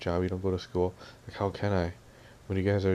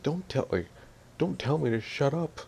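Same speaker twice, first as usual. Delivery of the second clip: imitating someone snapping back in a higher voice; retold yell; 7 semitones higher than usual. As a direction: act out an indignant telling-off, pitch raised. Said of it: "she yelled at me".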